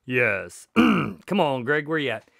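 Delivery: ordinary talk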